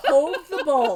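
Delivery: high, laughing mock British accent; hammed-up British accent through laughter; awful British accent. Mother teasing her son in a British accent. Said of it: "in my awful accent".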